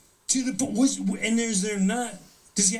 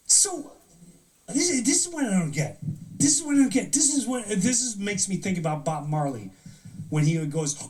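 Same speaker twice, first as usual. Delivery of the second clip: usual speaking voice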